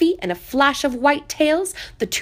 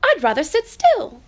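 a high, louder little-rabbit insistence; little rabbit insisting; 7 semitones higher, 5 dB louder than usual. Acted. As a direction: pretend you'd rather not. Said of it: "he said".

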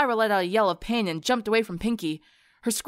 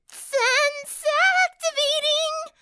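Pinkie voice; 3 dB louder, much slower than usual. Acted. Pinkie announces her sense.

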